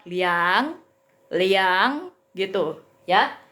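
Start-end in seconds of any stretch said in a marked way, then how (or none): 0.0-2.1 slow "liang" that dips and climbs; "liang" pushed down before it swings up; dipping third tone. Shown as a demonstration. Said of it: "ditekan dulu ke bawah".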